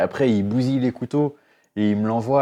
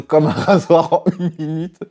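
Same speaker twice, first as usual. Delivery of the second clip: higher, louder, laughing through the words; 9 semitones higher, 8 dB louder than usual. Real, not acted. Mocking the video's razor claim to viewers, laughing. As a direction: laugh at how absurd it is.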